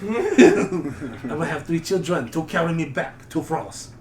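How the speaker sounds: mock French accent, slow and laughing; French adventurer. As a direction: boast the plan while cracking up. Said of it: "it's the same fucking vibe".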